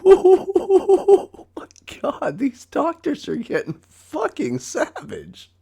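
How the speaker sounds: very high, slow, drawn-out squeal; squealing, laughing disbelief; shrieking disbelief. Real, not acted. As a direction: let it rise into an incredulous shriek. Laughing outrage at doctors.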